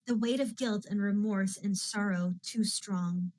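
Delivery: ordinary delivery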